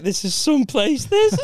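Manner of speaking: put-on Canadian accent climbing very high and loud; hammed-up Canadian accent. Canadian comic arriving at the rough venue, hammed-up Canadian accent. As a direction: push the Canadian voice into squeaky disbelief. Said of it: "He was like".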